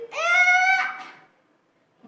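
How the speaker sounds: long high drawn-out wailing eh; long high fake-crying eh; mock wail. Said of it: "Je dis".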